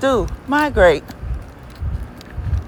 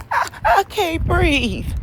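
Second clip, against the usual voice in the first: higher, whiny mock of the complaint; mocking whiny quote; 9 semitones higher than usual. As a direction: parody someone stammering helplessly. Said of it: "that says".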